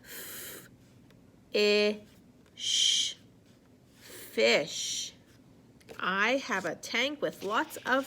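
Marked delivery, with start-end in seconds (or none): 0.0-3.1 F, I, sh sounded separately; sounding out words. Shown as a demonstration. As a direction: break it into sounds.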